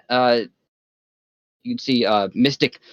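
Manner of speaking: usual speaking voice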